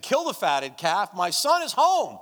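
father proclaiming a feast for his lost son, loud and overjoyed; joyful father's proclamation. Retold or imitated. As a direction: announce the son's return with delight. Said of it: "and he said".

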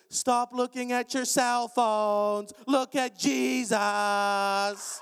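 very slow stern preacher voice; slowly ordering the crowd off their phones; slow, drawn-out command. Retold or imitated. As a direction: drag each word out like a rebuke. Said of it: "she starts going".

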